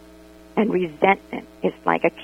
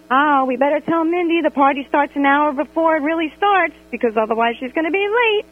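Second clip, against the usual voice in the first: lightly higher, conspiratorial group voice; mock scheming friends; 6 semitones higher than usual. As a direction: say it like a sly plan among friends. Friends scheming about late Mindy.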